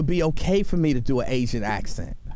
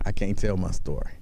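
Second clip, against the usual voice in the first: low, dragged-out lament; a touch of a low, mournful mock; 9 semitones lower than usual. Shown as a demonstration. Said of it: "it puts you in the mind".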